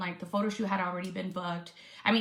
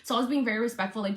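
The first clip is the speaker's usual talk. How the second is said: ordinary delivery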